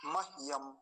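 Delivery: मह्यम as mah-yam, h without a vowel; half h. Shown as a demonstration. Teacher explaining हकार is halant, half h. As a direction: let the h in मह्यम run straight into yam. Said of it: "हकार हलंत है".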